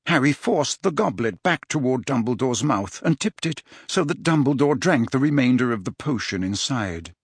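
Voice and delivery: plain reading voice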